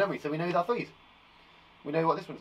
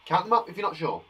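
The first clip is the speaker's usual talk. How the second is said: ordinary talk, nothing marked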